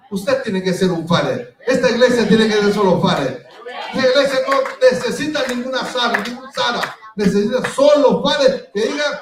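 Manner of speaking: loud, rousing preacher's cry with laughter; preaching at full force. Acted. Pastor rallying his church, loud fiery preaching. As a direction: stir the church to keep fighting.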